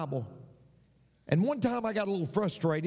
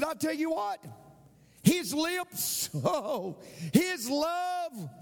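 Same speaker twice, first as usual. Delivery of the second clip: high, swooning wife's voice; 9 semitones higher, slower than usual. Retold or imitated. Wife gushing about her husband's lips, high and swooning. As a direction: gush it out like a smitten wife.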